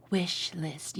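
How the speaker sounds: breathy sultry whisper; sexy whisper. Shown as a demonstration. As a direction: whisper it seductively. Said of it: "very, like, sexy".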